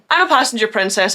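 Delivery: ordinary talk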